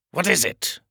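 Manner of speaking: high, sharp demand; impatient cry. Acted. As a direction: press for an answer. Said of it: "the king cried".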